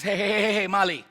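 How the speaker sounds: grandma halting a panic with repeated heys; grandmother cutting in. Retold or imitated. Grandma to mom about to freak out, urgent interruption. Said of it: "my grandma says".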